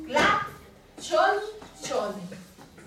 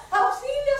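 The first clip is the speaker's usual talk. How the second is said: much higher and louder, a sing-song panicked call; frightened cry for help; 11 semitones higher, 5 dB louder than usual. Acted. Falling star calling out for help, high and frightened. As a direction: wail it like a little star falling from the sky.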